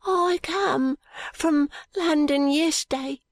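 put-on high, slow, halting boy's voice; feverish boy. A narrator's reading. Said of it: "said the boy himself, now flushed and hot".

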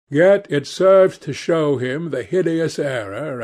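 ordinary delivery, nothing marked

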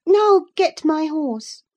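high, loud, slow command; bossy girl. Acted. Girl addresses her cousin like a stable boy, high and commanding. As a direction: order a servant about in a high girl's voice. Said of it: "as she would one of the stable boys".

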